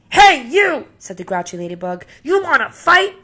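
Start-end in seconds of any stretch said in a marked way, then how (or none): 0.1-0.9 high, loud shout at a stranger; bully's call. Acted. Grouchy ladybug picks fight. 2.2-3.2 high, loud taunt with a rising end; bully's dare. Acted. Grouchy ladybug picks fight.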